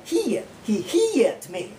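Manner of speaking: "hit" drawn out as "He it"; two syllables for emphasis. Shown as a demonstration. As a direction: stretch "he it" for emphasis. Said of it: "we do that for two syllables".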